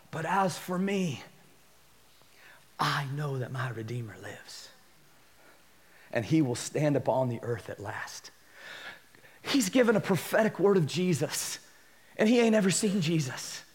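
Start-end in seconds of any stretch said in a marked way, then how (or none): 0.1-8.3 hushed reading. Acted. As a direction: read it like a sacred confession. Reading Job's words reverently.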